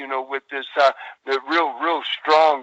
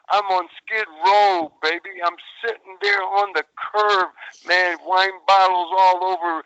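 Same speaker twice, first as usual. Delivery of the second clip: ordinary delivery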